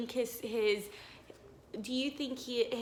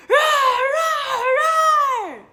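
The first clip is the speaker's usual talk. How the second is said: big, high shouted roars; loud roaring; 14 dB louder, much slower than usual. Shown as a demonstration. Teacher roars for the class, loud and high. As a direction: roar like a wild monster. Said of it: "do you think they're being loud".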